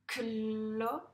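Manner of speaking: "Cloche" drawn out loudly as cllllo... chhhe; sounded-out reading. Shown as a demonstration. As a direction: blend "Cloche" slowly, holding each sound. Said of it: "on le lit ensemble". (« Cloche » déchiffré fort, sons très allongés)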